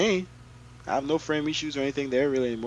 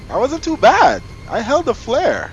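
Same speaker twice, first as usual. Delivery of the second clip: excited; 13 semitones higher, 13 dB louder than usual. Real, not acted. Proud after a good landing.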